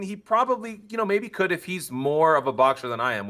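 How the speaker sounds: normal talking voice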